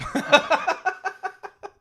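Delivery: high burst of laughter. Real, not acted. Streamer cracks up at a chat dad joke, high loud laugh.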